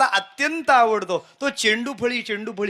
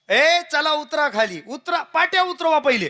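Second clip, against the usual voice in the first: higher-pitched official ordering passengers down; raised-pitch mock authority; bossy ticket checker; 7 semitones higher than usual. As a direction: call out a brusque order, lightly acted. Acted ticket checker ordering boys down.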